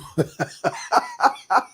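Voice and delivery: high, rhythmic chuckling laugh; real laugh. Real, not acted. Laughs after mock party chant.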